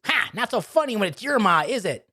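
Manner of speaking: put-on high, gloating little-boy taunt; taunting Dirty Johnny. Boy taunting his shocked dad, high gloating voice. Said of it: "He says".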